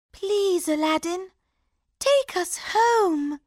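high, pleading princess; begging to go home; cartoon princess. A narrator's reading.

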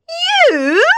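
high, loud, mocking "You?"; scornful shocked sisters. A narrator's reading. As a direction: sneer in disbelief. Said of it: "say the sisters".

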